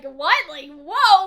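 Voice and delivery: loud startled what and whoa; a hammed-up jolt of surprise; mock surprise. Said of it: "doesn't have any, like, surprised reaction".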